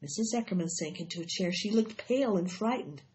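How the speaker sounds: plain reading voice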